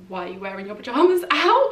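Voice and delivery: loud retold challenge from parents; imitating parents. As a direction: quote your parents' loud disbelief. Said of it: "my parents and they straight up were like".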